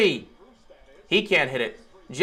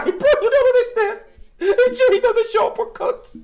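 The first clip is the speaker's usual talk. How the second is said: much higher, squeaky laughing whine; laughing plea; 4 dB louder than usual. Real, not acted. Fan pleading that Jimmy must show up, laughing whine.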